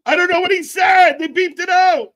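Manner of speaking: high loud exclaiming; excited shout. Real, not acted. Reacting to bleeped swearing, loud amused shout. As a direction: shout it out gleefully. Laughing at censored cursing.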